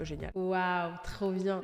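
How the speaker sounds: bas et éteint, faux enthousiasme; féliciter à mi-voix sans y croire; ironie blasée. Real, not acted. Ironie amère sur son ex. (sarcasme las, voix basse)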